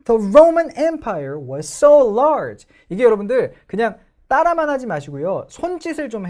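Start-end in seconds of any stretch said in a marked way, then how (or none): 0.0-2.7 very slow and loud, Roman and so punched; The Roman Empire was so large with loud stresses; stressed reading. Shown as a demonstration. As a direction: conduct each stress with your voice. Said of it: "정말 강세가 들어가는지를 보세요".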